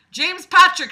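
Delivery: high, loud summons by full name; aggressive. A narrator's reading. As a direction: call the name out sharply, as a scolding. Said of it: "she said aggressively".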